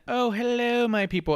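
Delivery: high, lilting imitation of a put-on British accent; mock British accent. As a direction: greet them in a hammed-up British voice. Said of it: "she's doing the British accent".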